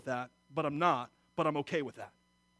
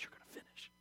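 gentle whisper; 22 dB quieter, faster than usual. Retold or imitated. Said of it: "all she kept on saying to me was".

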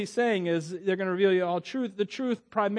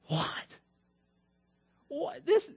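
mock-baffled hushed "what"; hushed, high-pitched bewilderment, played for comedy; puzzled disbelief; 10 semitones higher, much slower than usual. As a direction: imitate confused disciples, whispery then squeaking high. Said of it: "they must have had that puzzled look".

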